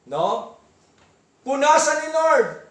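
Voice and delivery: ordinary delivery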